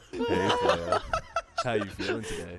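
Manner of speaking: playful put-on seductive voice; sexy voice. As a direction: ham up a smooth sexy voice. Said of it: "Why does he get a sexy voice then?".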